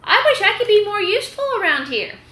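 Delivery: high, quick put-on bird voice; lilting high peacock voice; peacock's wistful wish. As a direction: pitch it way up for the peacock. Peacock voiced in read story.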